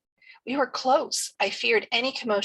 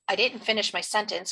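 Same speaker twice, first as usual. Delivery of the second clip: ordinary delivery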